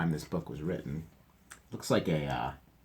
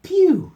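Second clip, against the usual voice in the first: pew; 13 dB louder than usual. Acted. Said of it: "said Papa".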